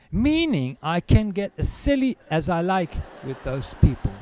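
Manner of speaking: ordinary talk, nothing marked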